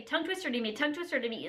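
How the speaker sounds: quick, run-together repetition; fast tongue twister. Shown as a demonstration. Showing how they would do the challenge, rapid delivery. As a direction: race through it as a tongue twister. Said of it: "like, if I was doing it".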